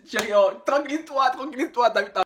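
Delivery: high, pleading calm-down voice; soothing. Acted. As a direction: hush a panicking friend. Soothing a panicked caller.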